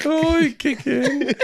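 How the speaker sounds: high, loud cockney urchin cry; old london cockney. Pretend. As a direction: belt it out like a London street kid. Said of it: "like Victorian street urchins".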